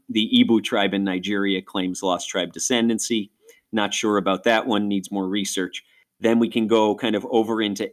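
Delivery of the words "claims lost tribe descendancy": usual speaking voice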